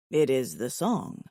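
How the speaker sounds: low, even alien voice; steady, low and earnest; calm alien suitor. Acted.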